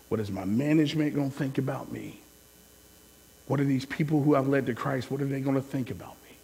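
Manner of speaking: low, soft, hushed questions; worried inner thoughts. Retold or imitated. Remembering thinking what pastors and family will think, quiet worry. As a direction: murmur it low, as if fretting to yourself.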